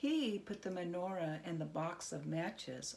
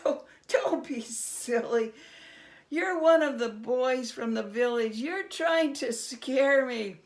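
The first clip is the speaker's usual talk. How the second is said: higher, louder, teasing dismissal; scoffing Herschel; 5 semitones higher, 11 dB louder than usual. Acted. Herschel laughing off the goblin as a village boy. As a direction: brush him off like a village prank.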